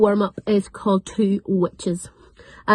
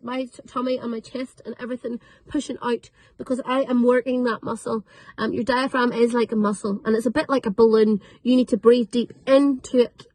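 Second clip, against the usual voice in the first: usual speaking voice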